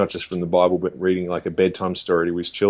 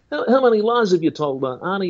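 high-pitched evangelist impression; Ray Comfort; 10 semitones higher, 3 dB louder than usual. Evangelist questioning the action star about lies, high put-on voice. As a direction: quiz him in a raised, put-on preacher voice. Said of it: "If you're like".

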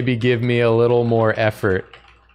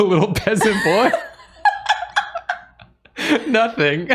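laughing. Acted. Reads line, laughs.

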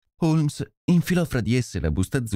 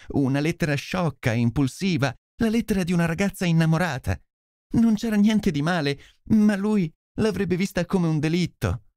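higher, pleading voice of a frightened lady; anguished noblewoman; 6 semitones higher than usual. Acted. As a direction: beg the detective for help, voice raised high. Lady Hilda's anguished confession. (nobildonna angosciata che implora aiuto)

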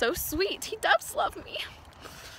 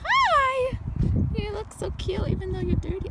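high sweet talk to a dirty pony; cooing; 8 semitones higher than usual. Real, not acted.

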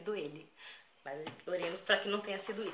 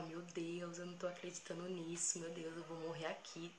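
soft, murmured inner prayer; hushed dread; 10 dB quieter than usual. Retold or imitated. Girl walking away from robber, hushed fearful praying. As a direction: retell fearful thoughts under your breath.

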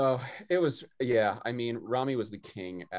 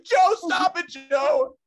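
very high, loud exasperated shout; mock angry yell; 10 dB louder, much slower than usual. Dad tells teasing son to stop, exasperated yell. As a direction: yell it as a fed-up dad, hammed up.

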